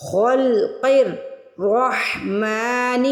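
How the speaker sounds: خلق الرحمن with heavy kha, qaf and ra, very slow; slow tajweed chant; slow heavy recitation. Shown as a demonstration. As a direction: recite it slowly, all three letters full. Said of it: "ان تینوں کو موٹا پڑھنا ہے".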